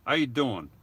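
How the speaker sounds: punchier New York "How you doing", leaning on "you"; hammed-up New York accent greeting. Shown as a demonstration. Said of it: "I'm gonna say it with my New York accent".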